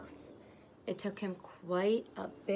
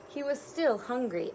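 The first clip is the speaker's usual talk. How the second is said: ordinary delivery, nothing marked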